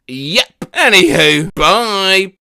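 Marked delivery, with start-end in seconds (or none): none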